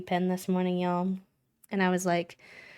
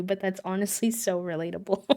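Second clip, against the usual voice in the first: normal talking voice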